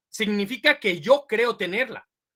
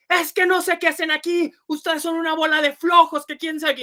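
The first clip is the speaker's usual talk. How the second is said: higher, louder scolding rant; scolding boss; 7 semitones higher, 4 dB louder than usual. Retold or imitated. Boss scolding his employees, angry and higher. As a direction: tear into the lazy staff. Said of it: "y nos empezó a regañar".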